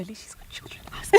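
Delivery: hushed, voiceless aside; whispering. Real, not acted. Co-host urging the other to ask the mother, whispering. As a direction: whisper it conspiratorially to your co-host. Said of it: "why are we whispering?".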